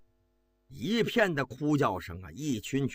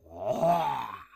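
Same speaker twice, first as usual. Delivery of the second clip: anguished wail; 3 semitones lower than usual. A narrator's reading. Soldiers' wail after the news.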